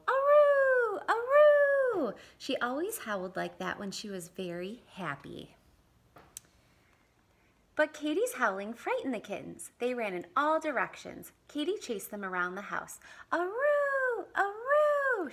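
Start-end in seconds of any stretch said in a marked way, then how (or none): 0.1-2.1 high, loud, long howling aroo; dog howl. Acted. Dog howls for joy at the kittens, loud and high. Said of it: "she howled". 13.3-15.3 high, loud, long howling aroo; dog howl. Acted. Dog howls for joy at the kittens, loud and high. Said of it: "she howled".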